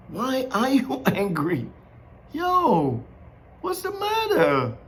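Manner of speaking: casual, half-laughing questioning of Cain; lightly voiced God. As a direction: lightly play a puzzled God checking in.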